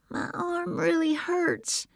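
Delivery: put-on high, slow child voice holding back tears; a small boy in pain, fighting tears; hurt little boy. A narrator's reading. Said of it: "trying hard not to cry".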